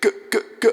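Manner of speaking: three high, loud hard G's; hard consonant bursts. Shown as a demonstration. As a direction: punch each G separately. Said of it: "use hard consonants".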